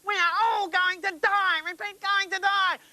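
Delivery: high, panicked wail. Pretend. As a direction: cry out as the plane goes down. Toy plane crashing.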